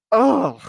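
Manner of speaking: loud, high ugh of disgust; disgusted groan. Real, not acted. Disgusted at the show.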